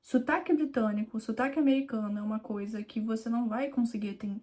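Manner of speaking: usual speaking voice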